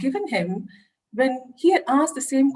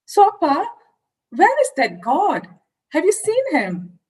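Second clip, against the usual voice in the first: put-on higher and louder, a child pushing her father; eager little girl; 6 semitones higher, 4 dB louder than usual. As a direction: pester Appa like an excited little girl. Child presses father with questions.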